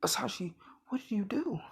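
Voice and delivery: high whispered gasp of a mother; horrified whisper. Acted. Inko seeing her son's arm, high shocked whisper. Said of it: "And she's".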